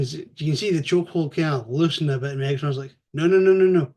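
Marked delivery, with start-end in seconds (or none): 3.1-3.9 louder, higher repeated no; loud denial. Retold or imitated. Megatron refusing to be called a slave, shouting.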